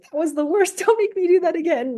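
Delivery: put-on whiny kid. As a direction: play the kid who hated it, whining through a laugh. Other person quoted.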